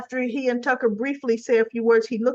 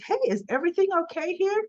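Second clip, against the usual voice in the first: high, fast and bright-voiced; host checking in; 8 semitones higher, faster than usual. Club owner checking on the tension, high and quick. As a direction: pop in breezily to check on them.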